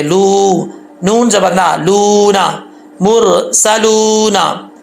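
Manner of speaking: slow मुरसलूना with लू held one alif; stretched waw. Shown as a demonstration. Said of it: "एक अलिफ की मिकदार खींचेंगे।".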